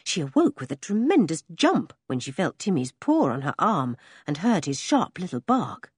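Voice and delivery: usual speaking voice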